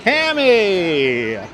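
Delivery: booming high TV-host hello; Lloyd Lindsay Young hello. Shown as a demonstration. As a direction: belt out hello like a TV weatherman. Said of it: "Pammy gets the big Lloyd Lindsay Young hello".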